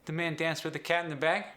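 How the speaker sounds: New Zealand accent, ending on "bag" with a slight rise. Shown as a demonstration. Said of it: "The short A sound shifts upward".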